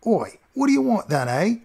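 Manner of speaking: high, slow put-on cockney doorman; a sniveling doorman straining to sound sophisticated in cockney; thick fake-posh cockney accent. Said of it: "his accent was so thick".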